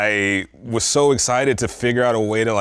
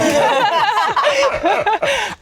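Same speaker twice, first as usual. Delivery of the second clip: high burst of laughter; 4 dB louder than usual. Real, not acted. Big laugh at his own joke.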